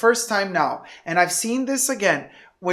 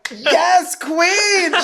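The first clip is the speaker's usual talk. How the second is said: higher, cheering encouragement; cheering yes queen; 9 semitones higher, 4 dB louder than usual. Retold or imitated. Friend cheering Jessie's refusal, high excited cheer. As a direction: hype her up like a fan. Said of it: "I was like".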